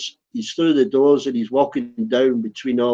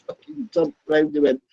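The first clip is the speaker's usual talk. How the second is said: normal talking voice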